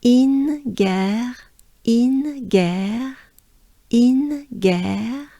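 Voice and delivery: "in guerre" drawn out, high on "in", French R in "guerre"; syllable demo. Shown as a demonstration. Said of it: "so far we have".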